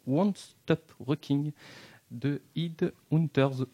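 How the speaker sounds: slow, careful English accent on the song title; French host spelling out English, slowly; shaky English accent. Real, not acted. Radio host announcing the song in halting English accent. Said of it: "vous allez voir mon accent anglais aussi".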